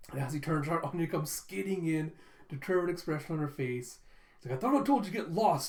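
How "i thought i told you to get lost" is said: quick, high, louder telling-off; acted irritated rebuke; annoyed scolding. Boy to the girl who follows him, annoyed.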